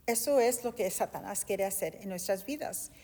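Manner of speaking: usual speaking voice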